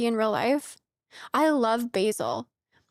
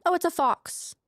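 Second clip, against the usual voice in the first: usual speaking voice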